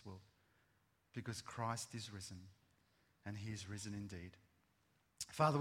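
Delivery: hushed pastoral prayer. Acted. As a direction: pray quietly with the congregation.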